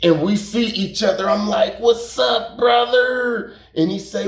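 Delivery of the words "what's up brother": higher-pitched, retold hail to a friend; called-out greeting. Greeting Country on the street at night, retold high hail. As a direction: re-enact hailing a buddy, pitched up.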